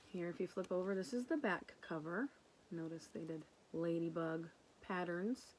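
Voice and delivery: normal talking voice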